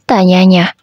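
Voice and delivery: usual speaking voice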